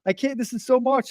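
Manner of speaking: retelling a breakdown in a high, teary voice; breaking down. Retold or imitated. Answering her physical therapist, in a high, tearful voice. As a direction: voice catching as if near tears, half-laughing. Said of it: "And I just broke down".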